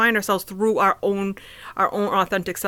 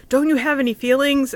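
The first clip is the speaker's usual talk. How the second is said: put-on higher-pitched child's plea, louder; niece's question; 7 semitones higher, 4 dB louder than usual. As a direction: ask it like a hurt little girl. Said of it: "she's like".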